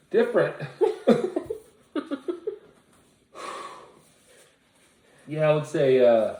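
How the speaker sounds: laughing. Real, not acted. Laughing through spicy burn.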